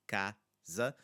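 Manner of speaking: casa split as CA, pause, za; casa, stressed open CA then separate za; two separate syllables. Shown as a demonstration. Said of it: "The word has two syllables".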